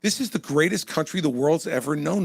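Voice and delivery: ordinary talk, nothing marked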